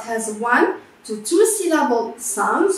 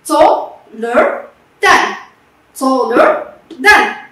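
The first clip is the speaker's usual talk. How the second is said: Taller than said slowly and loud for repeating; teacher repeating Taller than for the class; slow model drill; 5 dB louder, slower than usual. Shown as a demonstration. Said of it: "Plus E R, plus then".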